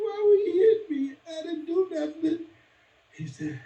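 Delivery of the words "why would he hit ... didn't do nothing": high, crying protest; crying boy. Retold or imitated. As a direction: sob out the unfairness. Boy crying to his mother.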